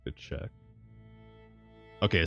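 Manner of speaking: plain reading voice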